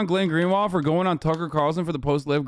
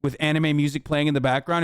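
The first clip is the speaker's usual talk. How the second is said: normal talking voice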